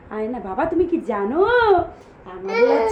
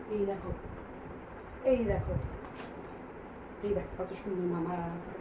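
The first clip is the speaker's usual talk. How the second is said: much softer, near a murmur; hushed coaxing; 7 semitones lower, 14 dB quieter, faster than usual. Real, not acted. Mother softly showing the baby a photo, hushed.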